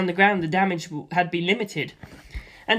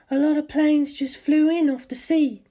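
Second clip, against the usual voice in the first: much higher female voice; higher woman's voice; mother on phone; 9 semitones higher than usual. Acted. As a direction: report it worriedly.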